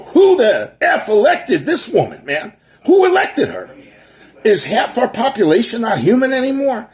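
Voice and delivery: high, loud incredulous yelling; exasperated outburst. Retold or imitated. Reacting to the senator on TV, loud disbelieving rant. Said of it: "I go".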